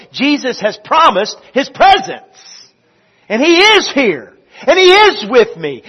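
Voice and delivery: building to high, loud proclamation; impassioned preaching. Real, not acted. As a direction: preach it with rising force, ringing out the key words.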